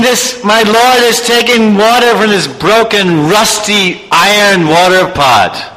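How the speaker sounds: ashamed devotee. Acted. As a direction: let the shame ring in his thoughts. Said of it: "He's thinking".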